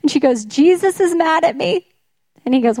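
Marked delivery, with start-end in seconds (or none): 0.5-1.9 high-pitched mock wail of complaint; lightly hammed-up tearful grievance; mock sobbing. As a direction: imitate a distressed old mother, voice lifted high. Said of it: "she's sobbing".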